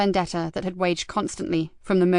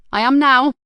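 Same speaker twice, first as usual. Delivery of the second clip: higher and louder, decisive quip; emphatic reply; 6 semitones higher, 8 dB louder than usual. Acted. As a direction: punch it out, pleased. Nikki accepts more whiskey.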